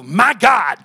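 loud, full-throated pulpit cry. Acted. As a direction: belt it out like a Southern Baptist preacher.

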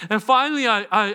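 ordinary talk, nothing marked

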